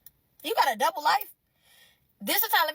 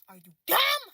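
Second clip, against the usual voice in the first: loud, higher scornful question; incredulous insult; 6 semitones higher, 5 dB louder than usual. Acted. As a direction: ask with open contempt. Insulting the texter.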